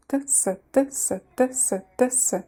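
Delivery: T, S said quickly in turn. Shown as a demonstration. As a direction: speed up the T S alternation. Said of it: "Now let's pronounce it more quickly".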